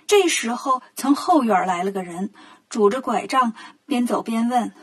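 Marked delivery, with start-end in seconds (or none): none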